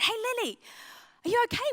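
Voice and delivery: put-on high, light concerned voice to a crying teen; a youth worker gently calling to a tearful girl; gentle caring check-in.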